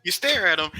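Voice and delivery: usual speaking voice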